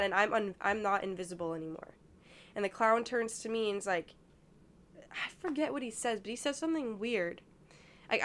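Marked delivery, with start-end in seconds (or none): none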